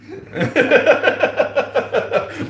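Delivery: high, loud laugh; big laugh. Real, not acted. Mick teases her and she bursts out laughing.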